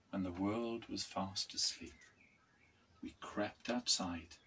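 ordinary delivery

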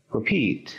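Repeat, second syllable stressed for learners. Shown as a demonstration. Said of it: "Encontramos el acento en la segunda sílaba".

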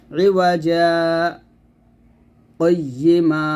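a short silent break between রিওয়াজা and কয়্যিমা without taking breath; saktah pause demo. Shown as a demonstration. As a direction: stop the voice briefly after রিওয়াজা, then carry on. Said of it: "এরকম".

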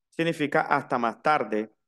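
usual speaking voice

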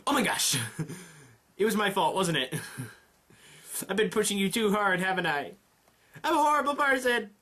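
high, distressed self-blame with nervous laughs; agitated. Acted. Emmy blaming herself to the runner, agitated. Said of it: "She's really agitated".

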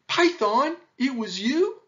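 loud, high, rising disbelief; astonished question. A narrator's reading. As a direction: ask it in sheer amazement. Astonished animals question Python.

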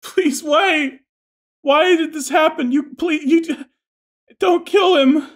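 high, loud wailing sobs; bawling. Acted. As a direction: plead through heavy crying. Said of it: "she is just bawling".

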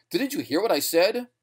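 high, insistent appeal; desperate question. A narrator's reading. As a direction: press them anxiously for an answer. Desperate man pleading with listeners.

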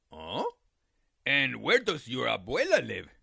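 low, sly coyote voice; a hungry coyote playing innocent; deep sly coyote.

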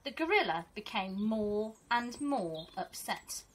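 teacher reading to her class, gentle and expressive; storybook reading. Acted. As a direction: read it like a teacher sharing a picture book with her class. Reads picture book to pupils.

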